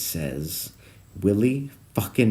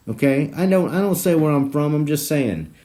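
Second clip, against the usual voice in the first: ordinary talk, nothing marked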